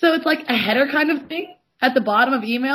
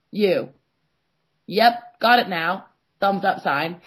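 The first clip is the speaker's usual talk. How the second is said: usual speaking voice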